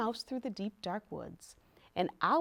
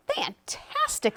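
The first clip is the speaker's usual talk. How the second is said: high, drawn-out word of praise; admiring; much slower than usual. A narrator's reading. Princess flatters dragon after his fire, admiring. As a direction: flatter with slow, high wonder.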